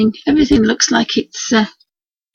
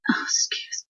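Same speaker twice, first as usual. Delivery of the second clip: usual speaking voice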